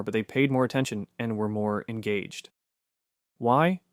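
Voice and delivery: ordinary delivery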